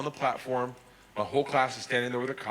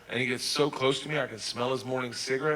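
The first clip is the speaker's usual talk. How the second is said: ordinary talk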